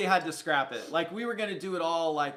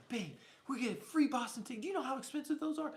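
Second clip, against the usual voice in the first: high, hushed retold excitement, speeding up; giddy; 8 semitones higher, 5 dB quieter than usual. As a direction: replay a kid-on-Christmas thrill without raising volume. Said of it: "I was giddy, right".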